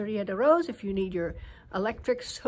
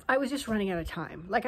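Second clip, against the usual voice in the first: usual speaking voice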